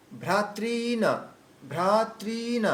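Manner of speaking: ভ্রাতৃণ with the ri drawn out slowly; long ri. Shown as a demonstration. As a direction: stretch ri in ভ্রাতৃণ, unhurried. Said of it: "টানতে হবে আর কি".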